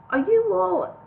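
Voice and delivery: worried. A narrator's reading. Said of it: "Bingo started to say".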